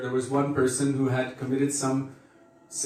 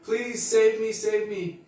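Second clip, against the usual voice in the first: mock desperate begging; 8 semitones higher than usual. Desperate man begging in story.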